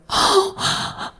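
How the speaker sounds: breathy, moved gasp; audible gasp. Acted. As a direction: gasp like a rapt congregation of women. Women gasp at the sermon.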